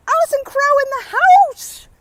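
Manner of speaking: high, loud cheerful announcement; excited shout-out. Real, not acted. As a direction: hype a friend arriving. Greeting passerby loudly.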